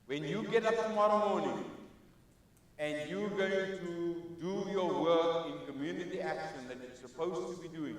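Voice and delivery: ordinary talk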